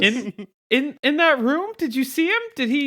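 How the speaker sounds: put-on high, rushed nerdy girl voice; nervous Velma voice. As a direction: play Velma, flustered. Velma voice continues.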